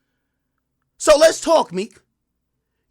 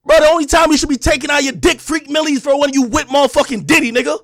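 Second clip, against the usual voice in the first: shouted, higher and louder; loud rant; 5 semitones higher, 6 dB louder than usual. Real, not acted. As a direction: blast it out in full anger. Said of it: "I came on with a lot of energy".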